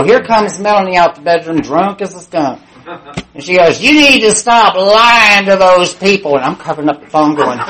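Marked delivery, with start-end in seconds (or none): 3.8-6.4 loud, high, drawn-out telling-off; drunken scolding. Acted. Drunk roommate catching her lying on the phone, loud scolding. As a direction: act out a roommate's slow, loud rebuke.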